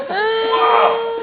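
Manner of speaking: very high, loud, astonished wow; amazed wow. Retold or imitated. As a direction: play the doctor stunned at the sight. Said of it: "He goes".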